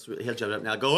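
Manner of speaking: ordinary talk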